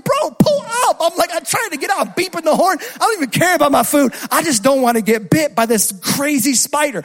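high, loud, frantic pleading; panicked yelling. Retold or imitated. Driver with spider yells at car ahead, frantic panic.

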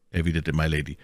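usual speaking voice

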